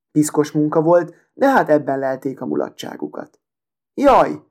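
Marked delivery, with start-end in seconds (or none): 3.9-4.4 sharp higher yelp, a bit louder; a quick "Jaj!" as a finger gets pricked; cry of pain. A narrator's reading. A street boy pricked by the needle cries out. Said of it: "kiáltott föl az egyik".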